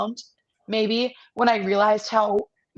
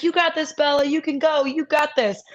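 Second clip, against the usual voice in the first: cheering; 7 semitones higher than usual. Retold or imitated. Friends cheering her on.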